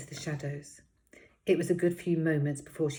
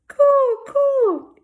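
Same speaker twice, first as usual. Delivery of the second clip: put-on very high, loud babbling baby cry; baby shouting coo; 15 dB louder than usual. Baby rabbit shouting for attention, shrill squeal. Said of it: "shouting at the top of her voice".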